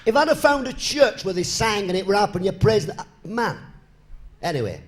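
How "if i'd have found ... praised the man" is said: preaching. Acted. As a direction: drive it home to the congregation. Preaches to the folks.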